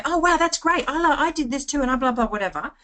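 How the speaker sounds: high-pitched, over-energetic gushing; overexcited reply. Acted. Coach acting a reply that scares people off, overexcited. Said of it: "that doesn't match their energy".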